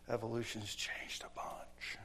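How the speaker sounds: slow conspiratorial whisper; whispered aside. Acted. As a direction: whisper it like a secret.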